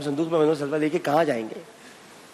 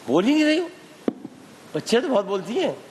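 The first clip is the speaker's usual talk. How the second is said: why won't you speak, rising up; exasperated; 7 semitones higher than usual. Real, not acted. Quiz host to silent girl, exasperated and higher.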